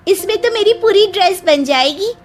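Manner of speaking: high, eager pipe-up; bright-eyed eagerness, pitched well up; excited young bride. Acted. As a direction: burst in delighted with the bargain. Bride pipes up at shop.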